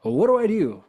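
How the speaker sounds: high, loud pleading question; a lost seeker begging for answers; seeker asking. Retold or imitated. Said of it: "Everyone's always wondering".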